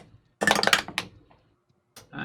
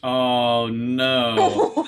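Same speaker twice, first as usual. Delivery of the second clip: dismayed oh no; 9 dB louder than usual. Real, not acted. Reacting to roll.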